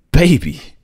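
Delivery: joyful shout across the street at his daughter; relieved shout. Acted. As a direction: call out to her as you finally see her. Father spots his lost child.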